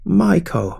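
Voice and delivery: high, narrated call of a name; crying out. A narrator's reading. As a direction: call it out, pitch up then falling. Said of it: "Then cried out".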